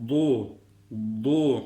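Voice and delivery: "Du" with tongue side on the molars; thick "Du". Shown as a demonstration. Teacher shows the Arabic letter dad, thick "Du". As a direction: press the tongue's side to upper molars for a heavy "Du". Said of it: "Kalın harf".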